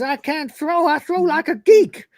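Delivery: high, slow, loud whine; a nervous man refusing to throw; high whiny protest. Acted. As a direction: whine it helplessly.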